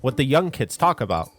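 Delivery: ordinary talk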